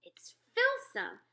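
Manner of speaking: slow, high loud cry of disgust; cried out. Acted. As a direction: wail it out, revolted. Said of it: "the B F G cried out".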